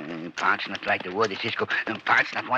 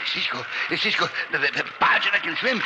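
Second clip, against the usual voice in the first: higher, hurried anxious calls; frightened calling; 8 semitones higher than usual. Acted. Pancho calling to Cisco from the flooded pit, anxious. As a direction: call out scared in the dark.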